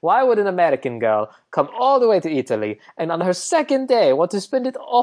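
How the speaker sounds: put-on Italian accent, musing doctor; amateur Italian accent with a lilting tune. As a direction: play a very Italian doctor reasoning aloud. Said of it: "we get a very Italian doctor".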